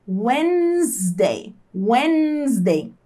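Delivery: Wednesday said slowly as WENZ-day, d silent; slow careful Wednesday demo; Wednesday pronounced carefully. Shown as a demonstration. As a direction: spell out Wednesday slowly, skipping the d. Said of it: "Repare na pronúncia".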